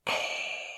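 long airy mechanical breath; mock respirator hiss; imitated villain breathing. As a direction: breathe like a masked movie villain. Imitating Vader's breath before quote.

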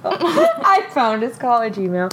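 amused, barely holding it together; laughing while talking. Real, not acted. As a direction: tell it through real laughter. Laughing at her own story.

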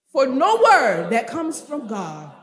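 "no word" belted loud, then descending through the phrase; emphatic recitation. Shown as a demonstration. Preacher prompting the congregation to repeat, "no word" loud and emphatic. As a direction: hit "no word" hard and let the rest follow. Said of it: "Let me hear you put emphasis on that again".

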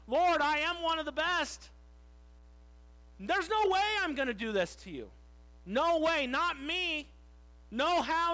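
loud, high-pitched boastful insistence; proud protest. Acted. Peter vowing to his Lord he will never fail, proud protest. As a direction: declare it with total cocksure certainty. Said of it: "Peter in his pride here is like".

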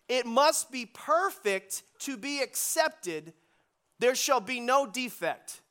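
slow, weighty scripture read; slow emphatic reading. Shown as a demonstration. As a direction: lay each word down deliberately. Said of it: "Now watch this".

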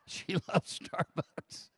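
breathy laughing aside. Real, not acted. Teasing the congregation about his wife, laughing. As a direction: chuckle through it.

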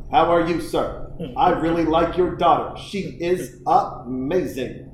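emphatic, measured sportscaster cadence; Howard Cosell impression. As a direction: politely greet a parent like a grand announcer. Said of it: "fifth grader that talked like Howard Cosell".

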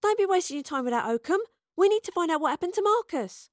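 high, agitated plea; urgent protest. A narrator's reading. Astrid urges the soldiers.

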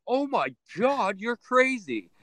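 high, slow mock disbelief; imitating stunned visitors. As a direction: drag it out like a gawking tourist.

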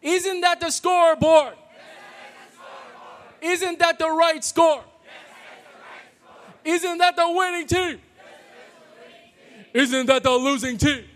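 shouted cheerleader call. Shown as a demonstration. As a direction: yell it like a packed rival gym. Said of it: "Pretend it's maniacs from Ottawa Hills".

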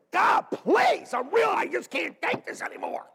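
desperate high shout at God; crying out to God. Shown as a demonstration. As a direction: cry out loud and high, pleading. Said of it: "That's how I cry out to God".

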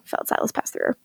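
hushed, breathy recap line; whisper. Real, not acted. Podcaster recapping a death scene, whispered. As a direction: drop to a secret whisper for the twist. Dramatic hush while recapping.

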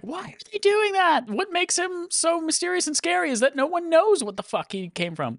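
high, whiny mock complaint; mocking fan voice. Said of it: "I know a lot of people were like".

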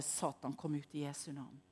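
quietly casting out the spirit; quiet command. Retold or imitated. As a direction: command without raising the voice. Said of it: "Jeg trenger ikke å rope eller skrike".

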